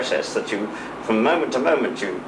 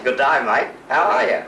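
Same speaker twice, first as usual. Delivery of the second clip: chirpy Aussie greeting, climbing in pitch; Australian accent; 2 semitones higher than usual. Shown as a demonstration. Englishman showing host how Australians greet each other, Australian accent. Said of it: "They say".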